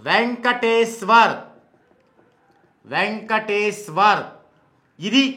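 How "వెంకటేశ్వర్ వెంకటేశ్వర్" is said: వెంకటేశ్వర్ stretched syllable by syllable; వెంకటేశ్వర్ ending on a clear 'war'; slow pronunciation demo. Shown as a demonstration. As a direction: sound out VEN-KA-TEE-SWAR slowly. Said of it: "వారంటే ఇది ఇలా రావటం".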